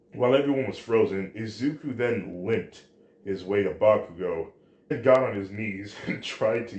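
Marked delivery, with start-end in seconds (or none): none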